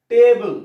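loud, isolated টেবল; টেবল said loudly for learners to copy; pronunciation demo. Shown as a demonstration. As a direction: call out টেবল clearly on its own. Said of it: "টেবিলটাকে উচ্চারণ করো".

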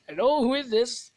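high, loud mock Indian accent; bad Indian accent. Answering the ringing phone, put-on bad Indian accent. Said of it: "This is my bad Indian accent".

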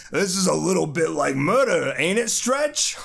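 put-on Ben voice, rising high on Stretch; gruff Thing teasing Reed. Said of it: "here he goes again".

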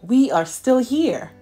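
ordinary delivery

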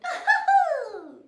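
high, loud cackle. Acted. Godmother delighted, loud cackle. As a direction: cackle with mischief.

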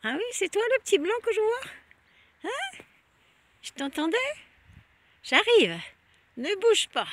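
high, loud pet-talk voice; high-pitched calling out to a guard dog. Real, not acted. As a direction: squeak up at the dog to win it over. Talks to a barking dog.

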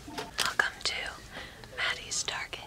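soft unvoiced ASMR whisper. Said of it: "A S M R".

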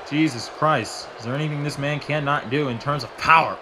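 ordinary talk, nothing marked

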